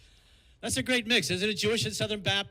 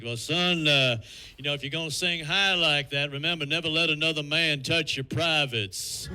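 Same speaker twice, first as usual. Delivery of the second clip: lightly voiced father; 5 semitones lower than usual. Worried father warning his son, a touch lower. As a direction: advise your son with mild Southern gravity. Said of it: "He's like".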